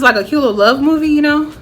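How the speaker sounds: ordinary delivery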